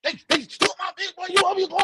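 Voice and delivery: angry husband losing it; imagined rant. Retold or imitated. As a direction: go off on them.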